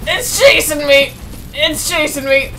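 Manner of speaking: panicked yelling while fleeing; scared horror game streamer. Real, not acted. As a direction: cry out in real fright. Monster chasing player.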